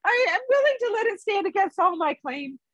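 a hobbit squirming through his confession; uncomfortable hobbit. A narrator's reading. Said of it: "said the Hobbit uncomfortably".

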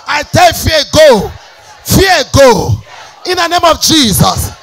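shouted declarations, high and loud; preaching at full force. Real, not acted. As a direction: roar it out to the congregation.

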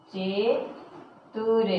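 Chatur stretched very slowly; drawn-out word. Shown as a demonstration. Teacher stretching Chatur for the class. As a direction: hold each sound of Chatur. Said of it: "drawn out for pupils".

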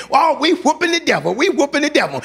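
high-pitched mock excitement; giddy, hammed-up bragging; excited boasting. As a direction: imitate followers crowing over a win. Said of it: "they came back excited".

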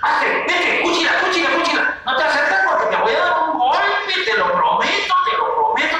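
high, agitated mock threats; hammed-up squeaky tantrum; acted anger. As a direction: shoo someone off in pretend fury. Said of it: "te enojas".

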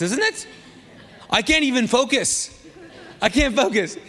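laughing through the words; cracking up at his own echo. Real, not acted. Pastor to congregation, laughing at his echo.

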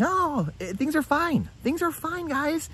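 high, mocking voice of a carefree passenger; lightly hammed-up naive optimist; mock complacent passenger. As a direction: parody someone who insists all is fine. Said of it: "most people on there saying".

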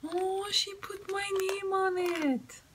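sustained high-pitched awe; touched delight. Real, not acted. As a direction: coo it high, touched by a gift. Touched by personalised gift.

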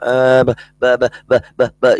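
mock flustered stammering. Mimicking the flustered atheist, stammering. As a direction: stutter as if caught out. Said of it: "this atheist was going".

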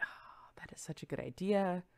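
hushed mock-sly whisper; retold whispered reply. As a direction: whisper it like a guilty secret. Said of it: "And I was like".

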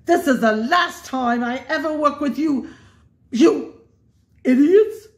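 rising to a high, loud furious roar; angry roar. A narrator's reading. Cruella roars at Horace and Jasper. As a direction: build to a full roar on idiots. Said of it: "roared at Horace and Jasper".

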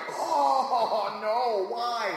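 mock dismay. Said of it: "That is just like".

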